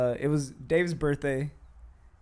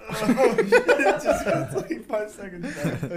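high, slow words through laughter; laughing; 6 semitones higher, slower than usual. Real, not acted. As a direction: talk while still cracking up. Laughing fit.

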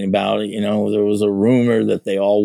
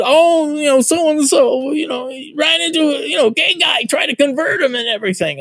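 much higher, breathless alarm; frantic retelling. Retold or imitated. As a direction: rush in to spread the news in a panic. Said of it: "and we said".

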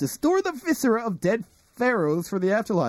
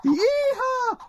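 cowboy whoop. Acted. Riding pony.

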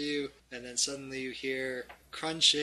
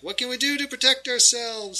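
higher, louder little character voice; worried halfling; 9 semitones higher, 8 dB louder, faster than usual. Acted. Halfling asking the party for protection, worried. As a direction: raise it into an urgent question. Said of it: "says the halfling".

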